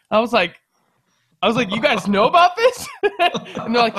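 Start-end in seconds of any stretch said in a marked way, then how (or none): 1.7-2.9 high, loud incredulous question; loud disbelief tipping into a laugh; amazed. Retold or imitated. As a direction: blurt it out, astonished, near laughter. Teller acts out his amazed question.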